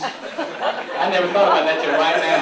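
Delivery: amused admission, laughing; laughing confession. Real, not acted. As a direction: own up to it, cracking up. Admits breaking open meeting rules.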